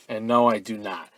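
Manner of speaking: normal talking voice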